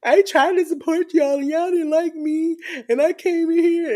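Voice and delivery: high, whining complaint; whiny voice. Retold or imitated. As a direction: whine it like a crybaby. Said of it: "he sounded like a whiny little bee".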